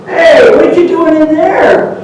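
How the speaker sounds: high, loud, slow call; curious coyote. Acted. Coyote calling to the rabbit in the cage, high and curious.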